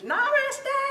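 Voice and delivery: high-pitched Brooklyn greeting. Retold or imitated. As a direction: impersonate Ma calling out her hello. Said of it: "in her high-pitched Brooklyn voice".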